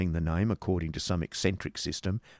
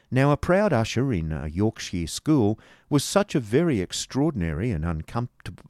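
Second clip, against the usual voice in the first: ordinary delivery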